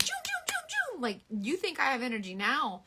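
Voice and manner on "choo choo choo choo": squeaky high train noise, imitating a wired self; mock train choo choo. Playing herself hyper on coffee as a train. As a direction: chug out a mock train whistle. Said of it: "I was like".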